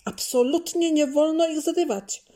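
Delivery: high, loud, firm no; firm refusal. A narrator's reading. Dad forbidding his daughter to pick protected flowers, firm and loud. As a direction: forbid it outright, every word firm. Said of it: "stanowczo powiedział tatuś".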